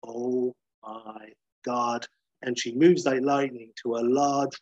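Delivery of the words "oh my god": slow, drawn-out dread of realizing; dawning realization. Acted. Shelby behind the glass as it dawns on her, stunned. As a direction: let it sink in word by word. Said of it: "realization dawning".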